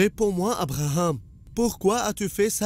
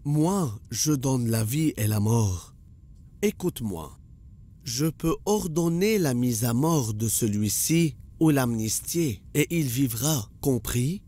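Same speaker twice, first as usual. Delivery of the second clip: menacing boast; 8 semitones lower than usual. Acted. King threatening Abraham with life and death, low menacing boast. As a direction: lean in low and make him feel your power.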